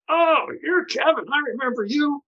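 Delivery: high, excited stranger's voice; imitating a wild-eyed newcomer gushing recognition; crazy guy's greeting. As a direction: greet him like an overeager oddball. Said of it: "insane guy walks up and goes".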